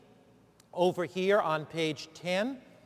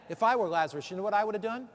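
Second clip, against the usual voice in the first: ordinary delivery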